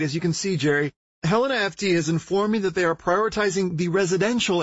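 high, urgent on-scene news report; a harried field reporter live at a fire; frazzled TV reporter. Acted.

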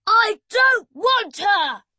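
loud, high chant of pray while winding; frantic shout. Acted. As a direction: yell it in a frenzy at the machine. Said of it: "he shouted".

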